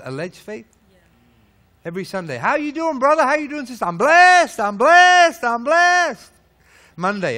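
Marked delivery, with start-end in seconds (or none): 2.4-3.8 higher, chirpy Sunday greeting; hammed-up friendly hello; mock cheery greeting. 3.8-6.3 much higher and louder, showy cry; hammed-up Sunday-best blessed; mock showy blessed.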